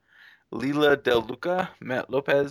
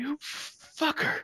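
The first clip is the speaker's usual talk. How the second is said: mock curse; 6 dB quieter, much slower than usual. Acted curse from comic scene.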